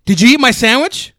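loud, high accusing yell; yelling across the room. Acted. Role play confronting sandwich thief.

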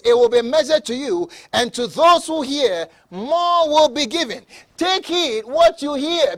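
high, loud, ringing declamation; full-force scripture preaching. Real, not acted. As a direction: proclaim each warning loudly with stretched key words.

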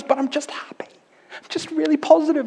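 high, whispered "I'm just happy"; breathy innocent whisper. Retold or imitated. Boyfriend answers Phoebe in a high whisper. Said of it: "he goes".

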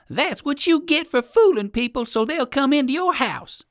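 put-on high, loud squirrel-boy taunt; calling out. A narrator's reading. Squirrel boy scolds the tricked owl, high calling taunt. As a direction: jeer it across from the treetops. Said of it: "called the squirrel boy".

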